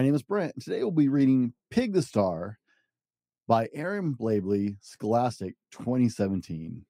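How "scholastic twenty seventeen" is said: usual speaking voice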